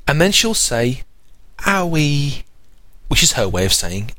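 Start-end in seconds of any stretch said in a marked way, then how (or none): 1.6-2.4 put-on high babyish owie; baby voice calling her sister; baby talk. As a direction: say it like an eighteen-month-old. Baby saying sister's name.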